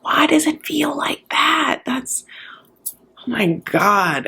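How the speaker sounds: slow, hushed, amazed whispering; awed whisper. Retold or imitated. Akini touching Benjamin's hair, slow awed whisper. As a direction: whisper it like touching something strange. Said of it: "is like".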